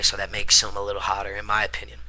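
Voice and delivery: ordinary talk, nothing marked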